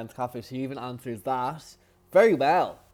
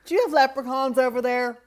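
high, loud mock American tourist; hammed-up clueless American; American accent; 10 semitones higher, 8 dB louder than usual. American fan asking the Irish about leprechauns, loud mock accent. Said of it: "do your American accent there".

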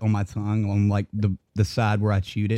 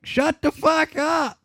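slow, much higher full shout; slower than usual. Retold or imitated. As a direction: yell it to silence the whole room. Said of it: "screaming at the top of their lungs".

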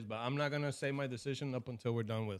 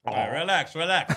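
loud, urgent relax relax; shouting; 12 dB louder than usual. Real, not acted. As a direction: yell at them to calm down. Yelling while laughing.

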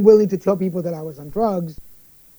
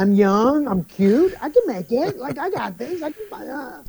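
much higher, mock-confident boast; hammed-up bravado, pitched up; cocky addict brain; 6 semitones higher than usual. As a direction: puff up like a reckless young addict. Voicing his addict brain's bravado.

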